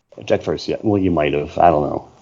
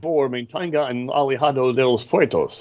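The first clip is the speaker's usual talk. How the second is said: monorail announcer voice; 6 semitones higher, 5 dB quieter than usual. Retold or imitated. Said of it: "that's my version of the narrator on the monorail".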